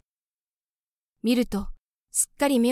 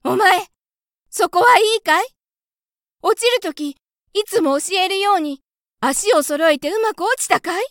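much higher and louder call into the cello; 9 semitones higher, 7 dB louder than usual. A narrator's reading. Mother mouse calling to her child in the cello, loud and high. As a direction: shout down to the child inside. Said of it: "中の子供に叫びました。".

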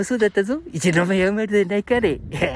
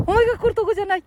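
high-pitched character voice turning someone away; high, put-on scolding; mock rebuff; 13 semitones higher, 4 dB louder, faster than usual. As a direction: send him off in a high puppet voice. Acts out older man's rebuff.

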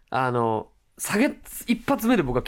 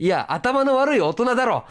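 higher, teasing jab from the back; mock heckle; 8 semitones higher than usual. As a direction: tease him from the back row.

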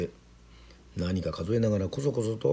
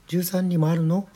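put-on high-pitched, louder child's question; wide-eyed little girl, much higher; surprised little girl; 11 semitones higher, 6 dB louder, slower than usual. A narrator's reading. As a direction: ask slowly in a high child voice.

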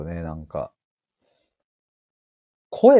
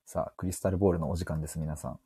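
mock guided meditation; 14 dB quieter than usual. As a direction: lead listeners into calm like a spiritual guide. Parody guided meditation, crystal ball. (ささやくような、ゆっくりしたパロディの瞑想誘導)

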